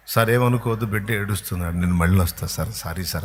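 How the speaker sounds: hurried apology. Acted. Mother excusing herself to minister.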